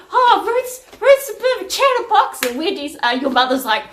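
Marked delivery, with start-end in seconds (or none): 0.1-2.4 very high, loud mimicked dad; dad impression. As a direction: imitate Dad gossiping, voice pitched way up. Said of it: "I said".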